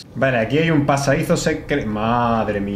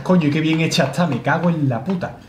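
ordinary talk, nothing marked

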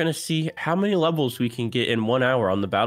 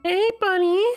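mock friendly; 3 dB louder, slower than usual. Greeting enemy in game.

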